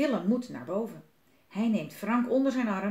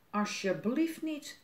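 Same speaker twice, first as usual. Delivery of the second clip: slow, slightly raised grumble; muttering; 4 semitones higher, slower than usual. A narrator's reading. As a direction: grumble it slowly to yourself. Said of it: "mompelt Willem". (langzaam mopperend)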